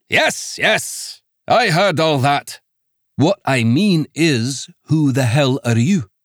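put-on thick Scots accent, impatient and brusque; a Scots magistrate brushing off the news. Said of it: "The accent was thick Scots".

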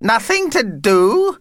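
high, slow, incredulous echo rising at the end; indignant disbelief. Acted. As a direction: throw it back as an affronted question. Vampire echoes it in disbelief.

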